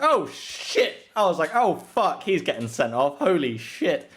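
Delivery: high, loud alarmed swearing; retold shock. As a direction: relive the panic of watching the injury. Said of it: "and I was like".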